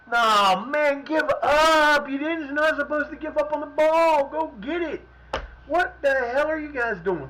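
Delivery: high, loud exasperated yelling; shouting at team. Real, not acted. Gamer shouts at his losing players, exasperated and loud. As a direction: scold them at full voice.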